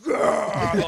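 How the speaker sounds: loud, high mock roar; imitated celebration growl; celebrating players' roar. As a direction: roar like players after a score. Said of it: "would get up and go".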